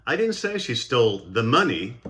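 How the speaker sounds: "money" picked out with a pitch swing; stress on MONEY. Shown as a demonstration. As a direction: put the emphasis on "money". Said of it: "stressing of certain words".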